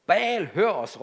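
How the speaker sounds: a read-aloud shout of pleading priests; shouting to Baal. A narrator's reading. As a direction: cry out to a silent god. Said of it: "råbte de".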